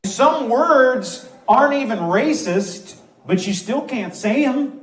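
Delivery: ordinary talk, nothing marked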